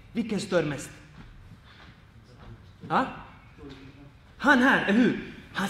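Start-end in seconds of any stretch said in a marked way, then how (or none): none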